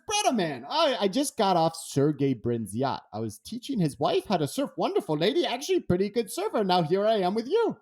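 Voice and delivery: high, easygoing surfer voice; lightly voiced laid-back surfer; Raymana voice. Voicing Raymana on FaceTime.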